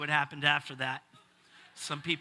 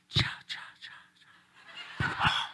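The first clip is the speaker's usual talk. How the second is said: breathy whispered cha sounds; the whisperers the son hears on the road; spooky whisper noise; much slower than usual. Retold or imitated. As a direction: whisper it like something in the dark. Imagined whispers in the dark.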